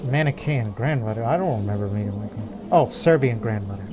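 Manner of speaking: ordinary delivery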